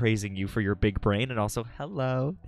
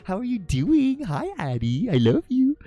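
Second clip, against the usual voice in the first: high, swooping, drawn-out coo; cooing greeting; 12 semitones higher, 5 dB louder than usual. Real, not acted. Streamer greeting a beloved viewer, cooing high.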